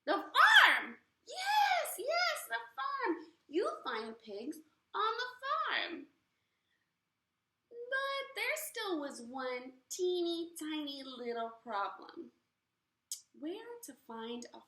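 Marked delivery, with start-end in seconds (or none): 0.1-6.1 very high, loud delighted witch; triumphant witch. Acted. 7.7-11.5 suspense narration. A narrator's reading. Storyteller builds suspense.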